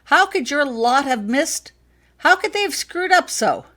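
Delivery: rising into an angry shout, high and loud; father raging at Harry over his daughter, loud and high. Acted. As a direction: let the anger build to a shout. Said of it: "His voice got louder".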